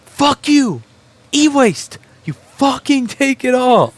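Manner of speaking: furious high yell at the pickup company; angry outburst. Real, not acted. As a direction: blast them, drawing each word out. Ranting at e-waste companies.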